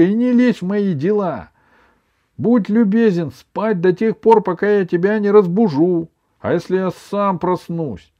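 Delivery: high, loud indignant granny; a grandmother telling off her grandson; scolding grandma. A narrator's reading.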